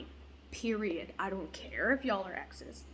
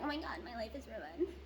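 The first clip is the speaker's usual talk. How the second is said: hushed, lightly hammed-up YouTuber panic; mock dramatic YouTuber; 8 dB quieter than usual. Vlogger showing what she won't be like, mock dramatic YouTuber. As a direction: softly mimic an overdramatic vlogger. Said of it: "trying to be all dramatic, you know, YouTuber".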